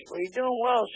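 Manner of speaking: high-pitched retold greeting; surprised greeting. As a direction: hail an old acquaintance in a high voice. Sergeant major greets him, retold.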